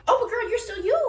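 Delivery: high, loud gushing reassurance; hyped encouragement. Retold or imitated. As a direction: pump her up like a hype friend. Hyping up a friend.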